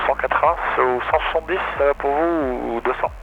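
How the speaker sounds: radio reply through the headset; over a pilot headset. Real, not acted. Pilot answering controller.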